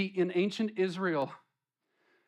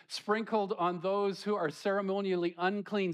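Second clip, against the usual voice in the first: ordinary delivery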